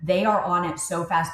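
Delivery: normal talking voice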